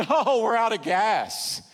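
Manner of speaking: laughing while answering, slow; laughing reply. Retold or imitated. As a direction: laugh it out together in a chorus. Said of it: "like a choir in unison".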